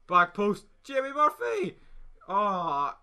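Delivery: very high, loud near-goal scream; excited yell. Real, not acted. Chance at back post.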